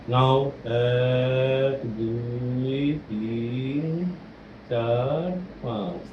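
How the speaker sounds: slow, fading count to five; slow breathing count. Shown as a demonstration. Coach counting a child's breath, slow and fading. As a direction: stretch each number for a long inhale. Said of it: "আস্তে আস্তে পাঁচ অবধি গুনতে হবে".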